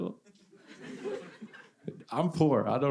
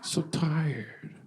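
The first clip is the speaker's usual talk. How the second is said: slow, dragged-out weary whine; a slow, whining complaint about being too tired; mock exhausted whine; 5 semitones higher, much slower than usual. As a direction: whine like you can barely stand. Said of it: "I'm too tired".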